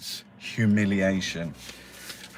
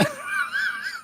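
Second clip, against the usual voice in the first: high wheezing laughter; squealing laugh; 8 dB quieter than usual. Real, not acted. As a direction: cracks up again. Laughs at own bit.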